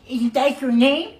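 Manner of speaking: slow mimicking echo of a question; mocking echo. Player throwing the coach's question back, mocking echo.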